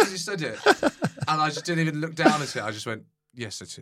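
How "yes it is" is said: low, soft matter-of-fact reply; retold deadpan admission. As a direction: answer quietly, without reacting at all. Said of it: "Like that".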